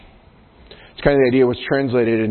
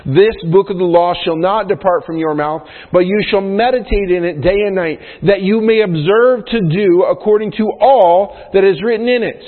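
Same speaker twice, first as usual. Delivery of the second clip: higher-pitched, louder Bible reading; scripture reading; 7 semitones higher, 4 dB louder than usual. Acted. Preacher reads the book-of-the-law verse aloud, emphatic scripture reading. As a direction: proclaim the verse with strong stresses.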